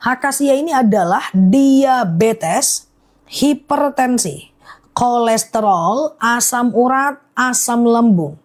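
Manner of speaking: slow, measured list of ailments; slow careful repeat. Real, not acted. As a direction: read out each item separately and deliberately. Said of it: "Aku ulang pelan-pelan ya". (membacakan daftar pelan-pelan, satu per satu)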